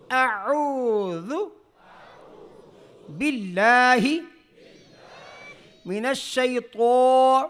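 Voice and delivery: very slow Quranic recitation; tajweed teacher reciting. Shown as a demonstration. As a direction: recite for students to repeat. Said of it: "میرے ساتھ پڑھیں".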